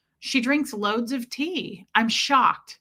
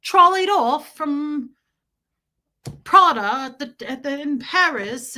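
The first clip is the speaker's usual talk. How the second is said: higher, slow, louder mock posh English; posh British impression; 5 semitones higher, 6 dB louder than usual. Streamer mocks Stella's Paris shopping in a hammed-up British voice. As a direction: glide through it like a London shopper. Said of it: "And she's like".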